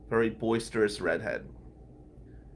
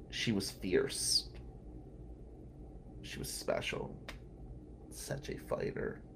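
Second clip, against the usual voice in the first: very soft, halting tribute; hushed, choked-up remembrance; real grief; 5 semitones lower, 12 dB quieter than usual. Real, not acted. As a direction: let the voice sink almost to nothing, mourning. Grieving tribute to sick friend.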